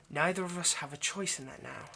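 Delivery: ordinary delivery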